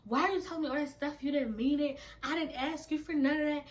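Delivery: high, rapid-fire scolding; heated retold rant, piling up accusations; going off. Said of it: "Straight going off".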